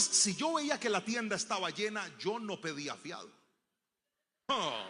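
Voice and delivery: ordinary talk, nothing marked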